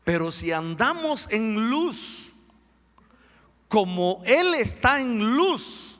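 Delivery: slow, high, ringing proclamation; preaching scripture. Acted. Preacher reading First John to congregation, slow proclaiming. Reads scripture aloud forcefully.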